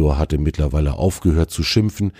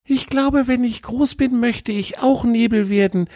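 put-on much higher small child's voice; a dreamy little fox girl musing; high childlike voice. A narrator's reading. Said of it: "sagte Grina, das kleine Fuchsmädchen".

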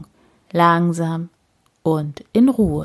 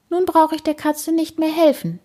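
a lightly voiced higher old lady; Frau Usebuse thinking; 10 semitones higher than usual. A narrator's reading. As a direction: think it aloud in her voice. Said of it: "denkt sie".

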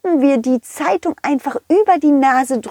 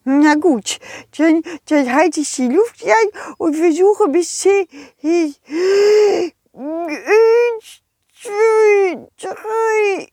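high, fast complaining snowman voice; the snowman pleads it tickles so much; whiny snowman; 6 semitones higher, slower than usual. Acted. Snowman counts, sneezes. (hohe, quengelnde Schneemannstimme)

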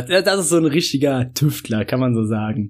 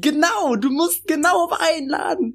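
much higher, worked-up complaint; indignant outburst; 12 semitones higher than usual. Real, not acted. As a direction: get fired up about vouchers. Indignant rant about gift vouchers. (empört und aufgebracht)

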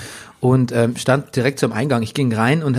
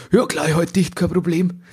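higher, put-on uncle voice; mock uncle; 4 semitones higher than usual. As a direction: play the blabbing uncle lightly. (nachgemachter Onkel, leicht höher)

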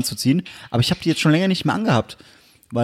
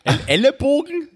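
much higher squeaky disbelieving echo; high mock disbelief; 10 semitones higher than usual. Repeats the odd phrase twice.

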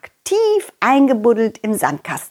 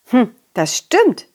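ordinary delivery, nothing marked

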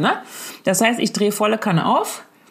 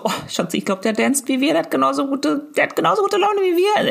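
gloss it over sweetly, pitch climbing; reassuring mother; 7 semitones higher than usual. Retold or imitated. Mother telling her son the man is just dancing, brightly reassuring.

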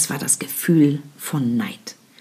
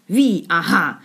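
sharp; slower than usual. Acted. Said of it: "gab Winnie scharf zurück".

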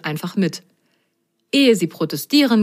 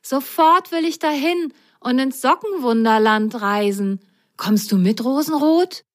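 put-on higher, keen young sock; eager sock voice; 6 semitones higher than usual. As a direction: urge a friend to come along. Grasgrün voice held.

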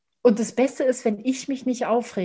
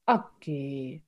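calm grandfather; 6 semitones lower, 4 dB quieter, much slower than usual. Retold or imitated. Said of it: "hat er sich neben mich gesetzt".

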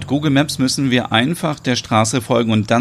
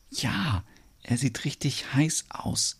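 much softer, slow and secretive; hushed aside; 2 semitones higher, 11 dB quieter, slower than usual. Acted. Friend secretly answering Ole that the farmer looks hot, hushed. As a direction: breathe it like a secret about him. Whispered reply behind farmer's back.

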